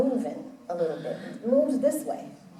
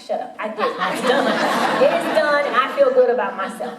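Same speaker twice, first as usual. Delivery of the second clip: laughing retort; 4 dB louder than usual. Retold or imitated. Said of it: "And I'm like".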